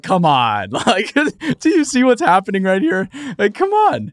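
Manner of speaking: laughing exasperation at a ridiculous safety guy; exasperated disbelief. Retold or imitated. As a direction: plead with him in amused disbelief. Exasperated quote of scolding guy.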